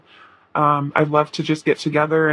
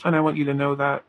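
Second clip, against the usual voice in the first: ordinary talk, nothing marked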